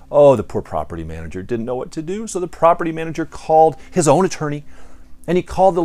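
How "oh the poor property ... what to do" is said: normal talking voice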